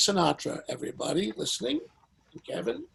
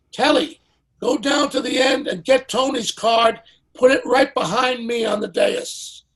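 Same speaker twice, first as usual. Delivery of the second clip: higher and louder orders to Telly; [name] giving orders; 6 semitones higher, 7 dB louder than usual. Retold or imitated. [name] ordering Telly Savalas to swap cards, a loud firm order. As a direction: boss him around. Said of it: "He says to Telly Savalas".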